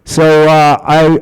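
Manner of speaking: ordinary talk, nothing marked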